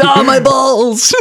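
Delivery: high, slow, strained cry of pain; tased teen boy, hammed-up squeal of pain; mock pained yelp. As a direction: ham up a guy just tased. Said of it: "And he goes".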